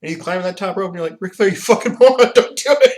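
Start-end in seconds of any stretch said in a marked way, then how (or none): 1.2-2.9 loud, high exasperated fan yell; frustrated fan yelling at a wrestler. Retold or imitated. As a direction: scream at him not to jump. Fan yelling at Ric Flair.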